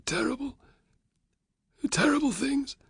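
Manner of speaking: high, hushed, trembling; fearful whisper. A narrator's reading. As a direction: whisper it as if afraid to say it. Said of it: "Varus nodded fearfully".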